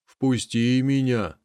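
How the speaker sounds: slow, odd calf voice; calf's plea. Acted. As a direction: calf asks the cat to let him in, slow. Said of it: "говорит телёнок".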